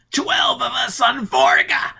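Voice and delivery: very high, loud, slow scream; desperate scream. Acted. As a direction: scream it out in terror. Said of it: "he screamed".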